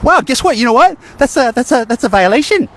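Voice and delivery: high, loud mocking announcement; mock outrage. Real, not acted. Vlogger catches viewers misusing technology, high mocking outrage. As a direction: announce it like catching them red-handed.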